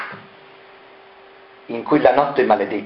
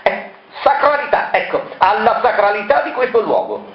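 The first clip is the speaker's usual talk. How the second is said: much higher, sudden accusing cry; shrill cry; 11 semitones higher than usual. Acted. Marella cries out at Variconi, accusing. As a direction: fling the accusation out high. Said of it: "che aveva gridato".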